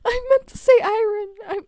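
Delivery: high, loud, choked with giggles; laughing protest. Real, not acted. Streamer correcting own slip to chat, laughing helplessly. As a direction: squeal the excuse through laughter.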